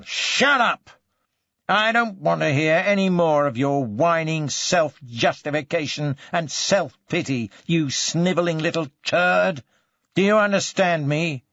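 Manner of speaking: high, loud burst of fury; angry outburst. Acted. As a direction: explode at the suspect and cut him off. Slams fist, rages at suspect.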